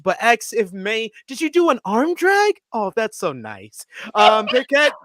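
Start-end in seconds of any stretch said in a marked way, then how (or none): 1.3-2.6 put-on high, patronizing voice talking to a child; condescending grown-up. Imitating Toni Storm talking down to her partner. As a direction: coo it down at her like she's six. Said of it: "Storm says".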